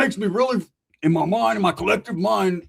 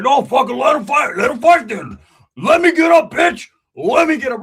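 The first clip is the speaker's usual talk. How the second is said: much louder, higher furious yelling; angry shout; 8 semitones higher, 9 dB louder than usual. Acted. Roach pinned down, yelling to fight. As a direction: scream to be let up. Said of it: "Roach was like".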